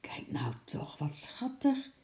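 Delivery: hushed, thrilled whisper; very soft, breath-only delight. A narrator's reading. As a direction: whisper it as if watching something adorable. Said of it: "fluisterde Lotje opgetogen".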